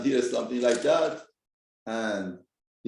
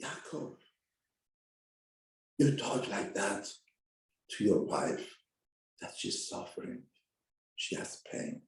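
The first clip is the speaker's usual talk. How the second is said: soft rebuke; 7 semitones lower, 9 dB quieter than usual. Acted. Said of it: "HaKadosh Baruch Hu says".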